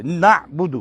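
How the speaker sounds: نعبد said carefully with a stop on the ع; slow model word. Shown as a demonstration. Teacher modelling the word for the student. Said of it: "special حرف پہ رکیں".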